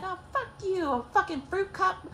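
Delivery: imitated scornful comeback; retold scornful retort. Sister snaps back in quarrel.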